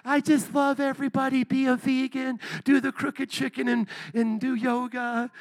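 a hint of a dreamy pixie; airy fairy voice. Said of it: "like one of those fairies".

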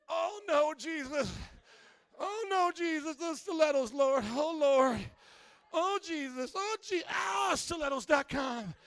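high, loud, swooning cries to the Lord; hammed-up shoe lust. Pastor acting out a lady at a shoe sale, hammed-up swooning cries. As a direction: swoon over stilettos like it's a temptation.

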